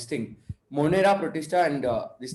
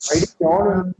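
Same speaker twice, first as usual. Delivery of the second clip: protesting; 5 semitones higher, 7 dB louder, slower than usual. Real, not acted. Denies yawning, teased by teacher.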